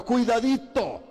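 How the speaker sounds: usual speaking voice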